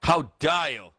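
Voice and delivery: loud, indignant mock shout; mock outrage. Co-host playing offended at the teasing, mock-outraged shout.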